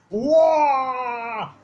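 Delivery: high-pitched kung fu battle cry; Bruce Lee yell. Retold or imitated. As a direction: let out a sharp martial arts kiai like Bruce Lee. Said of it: "thinking it was Bruce Lee".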